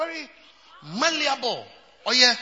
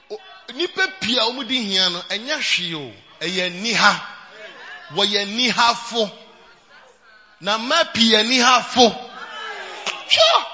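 louder, shouted preaching; preaching at full force; 7 dB louder than usual. Acted. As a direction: drive the point home to the crowd.

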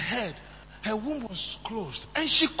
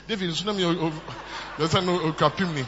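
mocking laugh; 5 semitones lower than usual. Michal voiced, laughing.

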